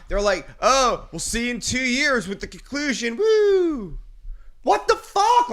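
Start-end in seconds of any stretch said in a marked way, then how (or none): 0.6-3.2 hammed-up teasing announcer, high and loud; mock the movie's smug cliffhanger promise; mock movie tease. Friend mocking the film's ending, hammed-up teaser voice. 3.2-5.5 high, loud outraged shout; outraged yell. Retold or imitated. Angry friend yelling about the movie ending, loud outrage.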